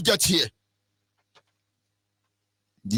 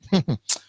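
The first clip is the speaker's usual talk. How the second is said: short laugh; 2 semitones lower than usual. Real, not acted. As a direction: chuckle to yourself briefly. Wry laugh at own tirade.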